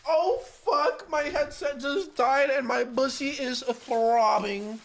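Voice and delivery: high, loud slow yelp; loud outburst. Real, not acted. Streamer yells as his headset dies, loud outburst. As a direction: blurt it out in shock.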